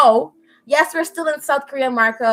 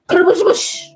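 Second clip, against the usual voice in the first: higher-pitched spoken crash effect; crash noise; 6 semitones higher than usual. Retold or imitated. Friend telling of a skateboard fall, imitated crash. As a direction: imitate a tumbling crash with the mouth. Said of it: "I heard".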